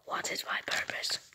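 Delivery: soft whispered robot voice. Acted. Robot wakes its owner.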